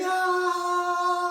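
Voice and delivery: long, high, loud scream; roller-coaster scream. Acted. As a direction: scream like a child on a ride. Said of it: "And they scream".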